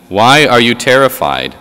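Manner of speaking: ordinary talk, nothing marked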